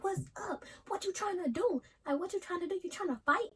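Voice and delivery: high-pitched, heated challenge; angry yelling. Retold or imitated. Girl calling out her rival as she leaves, angry high-pitched yelling. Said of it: "I'm yelling 'cause I'm mad".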